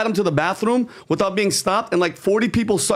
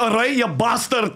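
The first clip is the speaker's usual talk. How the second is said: put-on Irish accent; 4 semitones higher than usual. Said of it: "if he was like".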